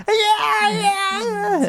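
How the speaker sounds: squeaky high-pitched yeahs. Retold or imitated. Voicing Spider-Man's enraged reply.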